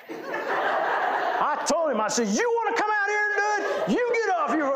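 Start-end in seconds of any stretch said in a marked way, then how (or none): 2.3-4.7 angry yell back. Retold or imitated. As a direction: fire it back at the boss in anger. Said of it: "Boy, I yelled back".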